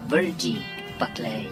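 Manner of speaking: normal talking voice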